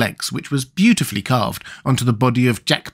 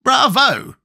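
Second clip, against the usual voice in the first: cried bravo; 12 semitones higher than usual. A narrator's reading. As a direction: cheer the clever plan. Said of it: "cried Nick Chopper".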